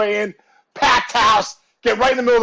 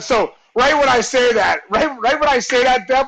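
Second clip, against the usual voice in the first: ordinary talk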